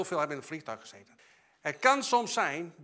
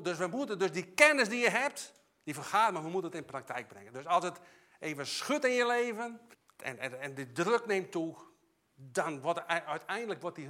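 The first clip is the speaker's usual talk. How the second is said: ordinary talk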